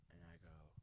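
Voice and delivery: usual speaking voice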